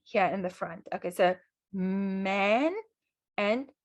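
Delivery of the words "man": MAN drawn out on its humming m; nasal sound demo. Shown as a demonstration. Teacher contrasting look-alike words, nasal sound demo. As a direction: hold the m of MAN, slow and even. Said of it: "a more nasal sound".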